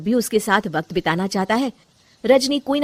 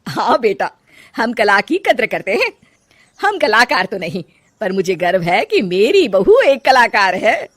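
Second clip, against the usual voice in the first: louder, proud declaration; proud mother-in-law; 6 dB louder than usual. Acted. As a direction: say it with pride. (गर्व भरी सास)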